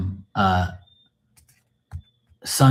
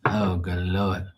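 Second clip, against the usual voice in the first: usual speaking voice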